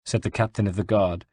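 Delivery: ordinary delivery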